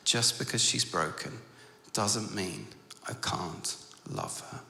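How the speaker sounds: soft, halting, sinking to a hush; tender declaration. Retold or imitated. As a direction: let each phrase land gently, with pauses. Girl declares love for broken doll.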